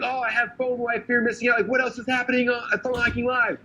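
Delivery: high-pitched mock panic at missing out; freaking out. Online viewers cut off from the event, freaking out. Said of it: "everyone who saw it was freaking out".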